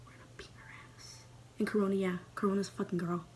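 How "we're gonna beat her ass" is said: quick hushed whisper; fast whisper. Acted. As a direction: rush it out in a whisper.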